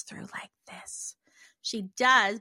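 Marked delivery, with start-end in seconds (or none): 0.0-1.2 soft unvoiced whisper, showing a gentle spirit's voice. Shown as a demonstration. Whispering to show listeners how the spirit Lila sounds. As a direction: drop to a hush as if she's right beside you. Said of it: "I'm hearing the whisper".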